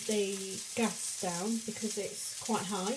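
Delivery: usual speaking voice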